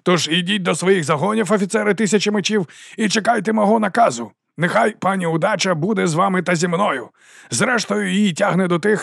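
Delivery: high, rousing send-off; ringing oration. A narrator's reading. Captain sends officers off to await orders, ringing oratory. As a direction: dismiss the troops with a grand flourish. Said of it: "Його голос дзвенів".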